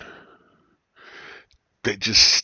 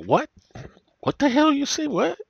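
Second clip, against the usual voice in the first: much higher, squeaky mock confusion; mock confused. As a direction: play the baffled listener, way up high. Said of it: "And I'm like".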